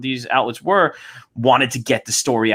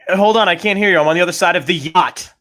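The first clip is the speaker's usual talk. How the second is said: raised, louder pretend distant call; mock calling out; 5 semitones higher, 4 dB louder, faster than usual. Rich guy calling across yachts to someone he can't hear, mock shouting. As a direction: call over as if from far off.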